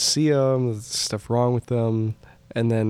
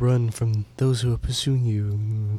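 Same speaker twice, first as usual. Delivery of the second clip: mock-ominous, stilted warning in a put-on voice; creepy doctor impression. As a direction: play along as the odd doctor. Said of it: "he kinda talks like this".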